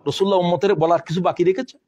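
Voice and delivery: ordinary talk, nothing marked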